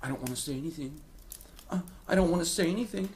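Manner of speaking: timid, mumbling mock voice; mocking a meek voice. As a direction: parody of someone too scared to speak. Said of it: "You're like".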